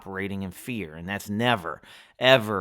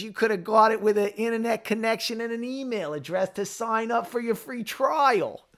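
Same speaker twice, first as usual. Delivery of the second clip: bad Boston accent; 10 semitones higher than usual. Quoting Good Will Hunting in a bad Boston accent. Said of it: "That's such a bad Boston accent".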